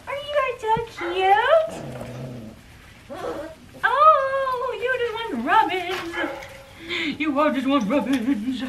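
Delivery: very high, slow, soft coo. Real, not acted. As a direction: croon to puppies wanting rubs. Cooing to puppies.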